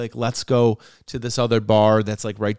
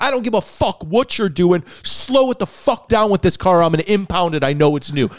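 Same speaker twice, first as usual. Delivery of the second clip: high, loud cop rant cutting off any excuse; imitating yelling cop; 8 semitones higher, 5 dB louder than usual. Cop threatening to impound the new car, mock yelling. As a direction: lay into the driver, mimicking an irate cop. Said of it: "he's fucking yelling at me like this".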